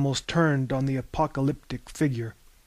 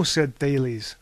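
ordinary delivery

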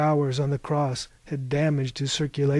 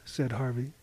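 ordinary delivery, nothing marked

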